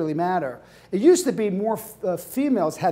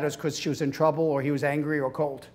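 ordinary talk, nothing marked

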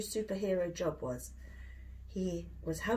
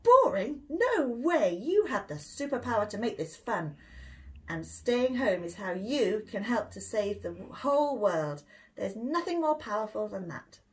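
enthusiastic mum; 3 dB louder than usual. Acted. Mum cheering up her grumpy son, loud and excited. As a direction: sweep the grump away with enthusiasm.

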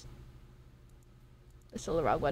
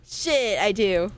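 ordinary talk

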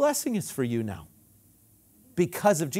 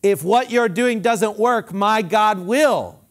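bold faith declaration; 4 semitones higher, 6 dB louder, slower than usual. Retold or imitated. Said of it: "My mom talked that way".

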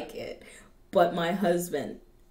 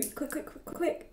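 quick, whispered patter, much softer; hurried muttering; 6 semitones higher, 11 dB quieter than usual. Shown as a demonstration. Southerner showing she's always in a hurry, hurried whisper. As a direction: rattle it off under the breath.

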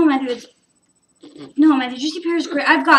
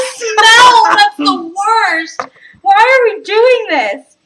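disgusted shout; 5 semitones higher, 7 dB louder, slower than usual. Real, not acted. Smelling the bad bean.

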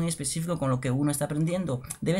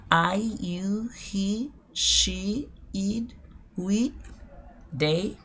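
one word at a time, rising like a list; slow demonstration; 4 semitones higher, much slower than usual. Shown as a demonstration. Teacher giving learners the pronouns, slow list delivery. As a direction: model each word separately with pauses. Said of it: "lo voy a hacer de manera lenta y despacio".

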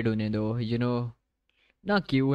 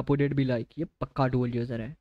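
ordinary talk, nothing marked

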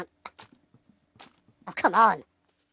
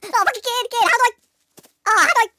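higher, louder flustered fumbling; frantic higher outburst; panicked; 9 semitones higher, 5 dB louder than usual. Real, not acted. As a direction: scramble for the right button. Panicking at game controls.

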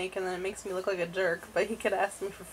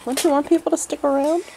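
loud, raised-pitch teasing protest; mock disgust; 5 semitones higher, 10 dB louder than usual. Real, not acted. Wife to undressed husband on camera, loud mock disgust. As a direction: protest loudly in playful disgust.

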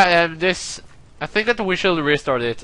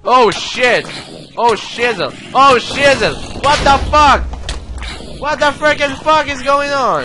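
alarmed exclaiming; 8 semitones higher than usual. Real, not acted. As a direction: cry out higher with each alarm. Exclaiming as attack happens.

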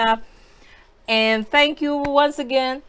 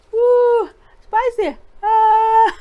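high loud yelps from the heat; burning mouth; 10 semitones higher, 6 dB louder, much slower than usual. Real, not acted. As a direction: cry out as the spice hits. Reacting to chili heat.

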